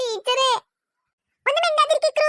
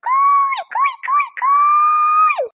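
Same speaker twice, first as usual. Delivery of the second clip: high, long-drawn "kooy" hoots; mocking hoot; 5 dB louder, much slower than usual. Children jeering at Ikru for needing the toilet, mocking hoots. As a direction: hoot shrilly and loudly to jeer. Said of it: "നമ്മളെ കളിയാക്കിയിട്ട് കൂക്കണോ?".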